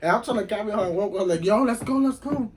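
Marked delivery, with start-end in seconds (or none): 1.4-2.5 urgent hurry-up. Retold or imitated. Urging a drunk friend along.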